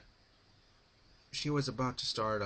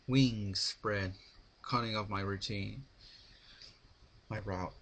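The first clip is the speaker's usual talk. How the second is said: ordinary delivery, nothing marked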